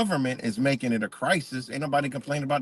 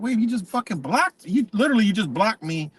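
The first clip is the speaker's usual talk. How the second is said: indignant disbelief; 7 semitones higher than usual. Retold or imitated. Confronting the host who blocked him.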